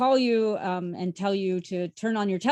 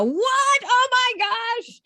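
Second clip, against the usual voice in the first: much higher, louder astonished cry; amazed gasp; 7 dB louder, much slower than usual. Retold or imitated. Librarian imitating patrons' high, loud, astonished What. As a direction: gasp it out as if you can't believe it.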